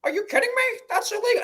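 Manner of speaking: high, shocked, scandalized voice; hammed-up fussy attorney; mock outraged lawyer. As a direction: clutch your pearls at the idea.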